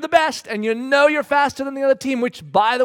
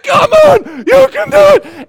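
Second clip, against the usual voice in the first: very high, full-throated yell; excited cheering; 14 dB louder than usual. Retold or imitated. Fans on their feet urging the swimmer, screaming cheer. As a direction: scream them on to victory. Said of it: "everyone's cheering".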